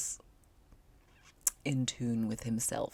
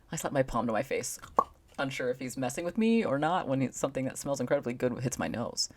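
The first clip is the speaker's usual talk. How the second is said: ordinary talk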